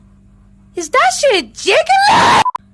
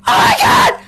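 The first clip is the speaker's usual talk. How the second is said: shocked shout; 9 semitones higher than usual. Real, not acted. Shocked at cat.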